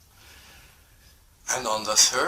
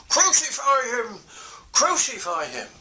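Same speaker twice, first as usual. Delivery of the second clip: crowd shouting; 7 semitones higher than usual. Acted. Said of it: "they were shouting".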